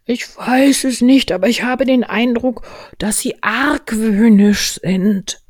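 high, loud, slow suspicious bear; Winnie der Pooh. Acted. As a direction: draw the words out conspiratorially. Voices Pooh in story. (hohe, langgezogene, verschwörerische Bärenstimme)

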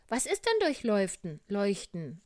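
high, loud, curious bear voice; little bear asking. Acted. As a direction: ask it in a piping small-animal voice. (hohe, neugierige Bärchenstimme)

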